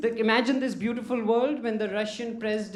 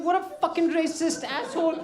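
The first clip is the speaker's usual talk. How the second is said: retold angry thought; 7 semitones higher than usual. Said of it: "I was so angry".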